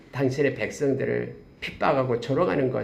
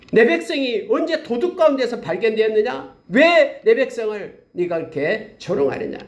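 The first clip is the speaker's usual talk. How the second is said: mildly stern, a bit higher rebuke; voice of God; 8 semitones higher than usual. Acted. God scolding Moab for mocking his people, mildly stern. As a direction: demand an answer from Moab. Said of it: "하면서 말하는 거예요".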